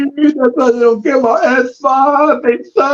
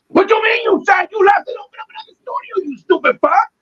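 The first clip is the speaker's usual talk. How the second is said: furious shout; 10 semitones higher than usual. Pretend. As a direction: hurl it like an outraged fan. Ranting at the tweet's author.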